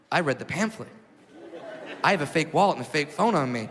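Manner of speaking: ordinary delivery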